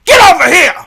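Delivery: loud, high hammed-up order; imagined yelling. Imagining people saying it, hammed-up yell. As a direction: yell it like a mock scolding. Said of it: "when I imagine people saying".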